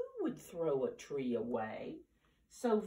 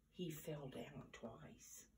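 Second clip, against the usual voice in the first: whisper; 14 dB quieter than usual. A narrator's reading. Narrator drops to a hush.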